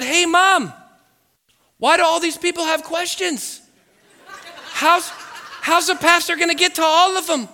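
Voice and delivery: put-on loud, high-pitched puzzled kid; hammed-up wide-eyed question; baffled youngster. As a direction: call out to Mom in naive bewilderment. Acting younger self asking mom in church.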